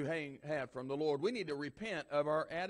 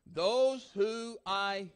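Those who I drawn out slow; stressed keyword; 4 dB louder, much slower than usual. Shown as a demonstration. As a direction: stretch Those who I, slowly and loud.

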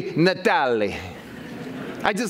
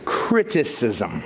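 deadpan drop; 11 semitones lower, much slower than usual. Acted. Punchline after what does he receive.

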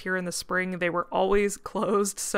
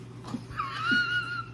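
high-pitched laugh; 3 dB quieter than usual. Real, not acted. Laughing at own joke.

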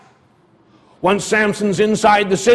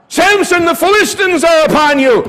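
crying out; 9 semitones higher, 5 dB louder than usual. Acted. Delilah's repeated alarm cry.

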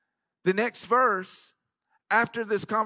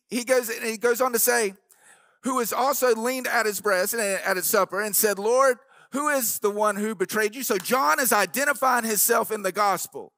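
rising, louder sermon delivery with organ behind; preaching at full force; 4 semitones higher, 4 dB louder than usual. Acted. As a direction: drive the point home to the congregation.

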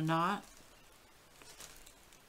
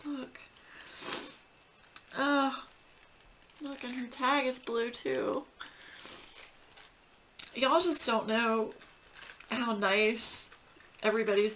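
high voice, close to tears; touched, holding back tears in a high voice; emotional; 5 semitones higher than usual. Real, not acted. Dog owner seeing a tag in late dog's honor, emotional. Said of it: "the emotional part of that".

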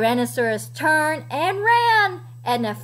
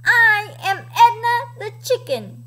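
higher, louder chicken boast; plucky storybook chicken; Edna; 6 semitones higher, 4 dB louder than usual. A narrator's reading. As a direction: announce it boldly, as a brave little hen. Chicken answers dinosaur's boast.

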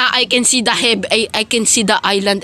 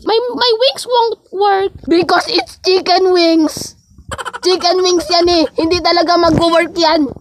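squeaky mock voice; 5 semitones higher than usual. Angel mocking a rival's chicken wings in a squeaky voice. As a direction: tease like a bratty cartoon.

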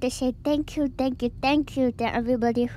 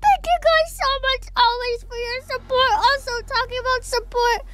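laughing; 11 semitones higher than usual. Real, not acted. Laughing thanks after mess.

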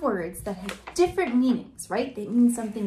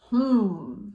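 plain reading voice

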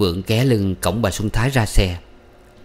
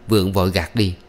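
plain reading voice

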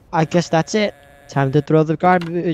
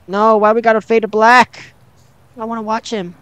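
high, loud complaint; loud protest; 7 semitones higher, 4 dB louder than usual. Real, not acted. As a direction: cry out against the cutscene ending. Protesting a sudden cut in game.

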